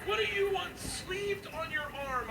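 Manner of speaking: high-pitched incredulous dad rant; hammed-up parental indignation, voice stuck up high; outraged disbelief. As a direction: squeal it in mock outrage. Dad acted outraged at tattoo request.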